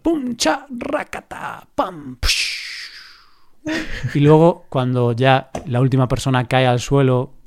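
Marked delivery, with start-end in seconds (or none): 0.0-2.5 much higher mouth-made punches and rattle; fight sound effects. Shown as a demonstration. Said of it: "puedes repetir de nuevo cómo es el sonido". (efectos de pelea más agudos)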